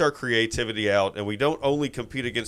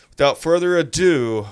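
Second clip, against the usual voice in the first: loud, high showman intro; announcer flourish; 5 semitones higher, 7 dB louder than usual. Real, not acted. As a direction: announce it like a host. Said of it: "host intro again".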